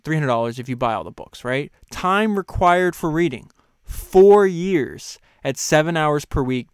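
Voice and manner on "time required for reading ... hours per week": louder, higher, slow read; slow, emphatic reading. Acted. Host reading the reading time to listeners, slow and emphatic. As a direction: lean on each figure. Reads list from the book.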